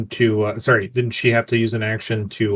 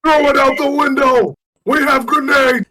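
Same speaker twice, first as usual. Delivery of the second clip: high, loud in-character yell; shouted threat; 6 dB louder than usual. Acted. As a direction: holler a warning to people inside. Pizzarelli yells back at the councilman.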